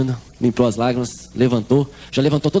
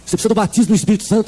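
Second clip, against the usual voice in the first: fast, rushed repeat of the church line; hurried retelling; 4 semitones higher, faster than usual. Retold or imitated. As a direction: rattle it off fast. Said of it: "Eu vou correr". (repetição corrida e rápida)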